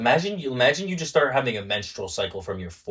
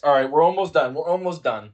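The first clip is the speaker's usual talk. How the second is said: ordinary talk, nothing marked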